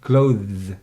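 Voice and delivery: "Clothes" loud, one syllable, drawn-out th-z ending; pronunciation model. Shown as a demonstration. Teacher drills pupil, loud one-syllable "Clothes". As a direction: punch "Clothes" and buzz the ending. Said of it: "And now try, please".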